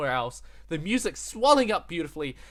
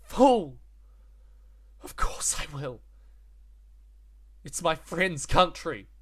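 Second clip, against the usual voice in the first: Luffy quote; 3 semitones higher, 6 dB louder, slower than usual. Retold or imitated. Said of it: "quote answered after Kinemon's line".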